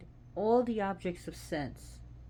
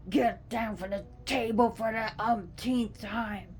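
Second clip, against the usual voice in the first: scolding the cat; 4 semitones higher, 4 dB louder than usual. Retold or imitated. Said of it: "You're like".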